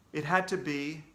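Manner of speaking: plain reading voice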